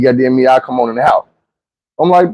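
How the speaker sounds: ordinary talk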